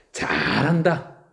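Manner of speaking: slow sarcastic drawl on 잘한다. Shown as a demonstration. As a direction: stretch 잘한다 out mockingly. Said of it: "비꼬는 말이 돼요". (비꼬듯 느리게 "잘한다")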